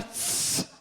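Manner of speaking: "Tss" as a long unvoiced hiss; exaggerated sibilant S. Preacher joking about a carioca's S, exaggerated hiss on "Tss". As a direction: hold the sibilant of "Tss" long, for comic effect. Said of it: "É carioca, em vez de falar".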